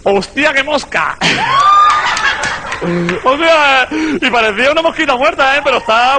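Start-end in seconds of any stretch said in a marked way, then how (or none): 1.2-3.2 shrieking laugh. Real, not acted. Comedian bursts into shrieking laughter at his own joke.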